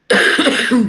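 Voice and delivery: a sharp, real chesty cough. Real, not acted. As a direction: cough out loud from the congestion. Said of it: "So if I'm coughing".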